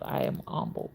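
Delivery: whispered humbled. Real, not acted. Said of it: "don't y'all forget".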